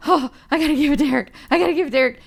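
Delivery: fast, high giddy rush; giddy wife bursting to give the gift; can't-wait excitement. Retold or imitated. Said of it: "I couldn't stand myself".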